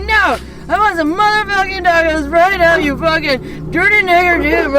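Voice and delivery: put-on loud high drunken rant; drunk yell. Drunk friend yelling slurs from the back seat, loud. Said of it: "he rolls down his window in the back and he's like".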